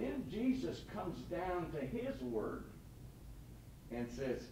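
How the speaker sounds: ordinary talk, nothing marked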